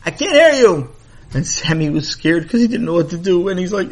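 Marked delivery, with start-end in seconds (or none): 0.0-0.9 high, louder call from far above. Acted. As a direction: call down from forty feet up. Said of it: "called down".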